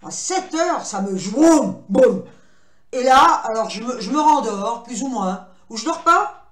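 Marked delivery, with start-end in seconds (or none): 0.8-2.2 loud, low "vroum, boum"; imitated crash noise. Vlogger telling how sleep knocks her out, booming sound effect. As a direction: act out the sudden thud of dropping off.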